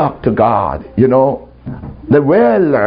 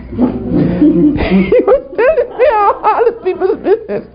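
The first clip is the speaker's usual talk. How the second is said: high, squealing through laughter; laughing. Real, not acted. Preacher admitting the devil's gossip, laughing hard. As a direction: say it helplessly cracking up.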